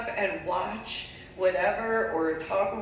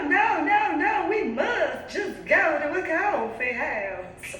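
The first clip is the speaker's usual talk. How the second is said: put-on high, shouted Southern Georgia accent; loud, slow Southern Georgia accent, insisting; Mary's Georgia accent, shouting; 11 semitones higher, 5 dB louder, slower than usual. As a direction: bellow it like Mary in a thick Georgia accent. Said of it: "if I'm on point with that accent".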